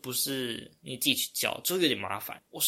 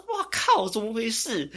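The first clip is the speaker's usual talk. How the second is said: sudden high-pitched shock at the class leaving; a real jolt of surprise, voice leaping up; shocked wow; 8 semitones higher, 3 dB louder than usual. Retold or imitated. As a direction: blurt it out, startled and much higher. Shocked at everyone leaving at once.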